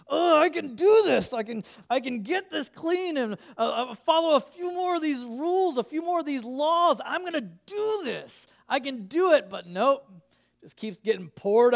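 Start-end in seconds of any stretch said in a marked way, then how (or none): 0.1-9.5 higher, louder, hammed-up can-do voice; pep yourself up like a man sure he'll scrub clean alone; cocky self-helper. Preacher voicing mankind boasting it can keep the rules, hammed-up.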